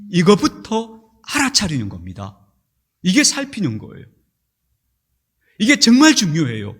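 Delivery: usual speaking voice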